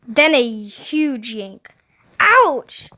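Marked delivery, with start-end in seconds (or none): 2.2-2.9 sudden high, loud "ouch"; yelp. A narrator's reading. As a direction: yelp it out in sharp pain. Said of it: "I yelped".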